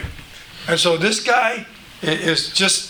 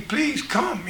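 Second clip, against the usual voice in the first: a soft, higher plea; mildly acted begging for help; 6 semitones higher, 6 dB quieter than usual. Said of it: "And he's begging".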